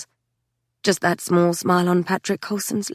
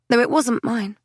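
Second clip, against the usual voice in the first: plain reading voice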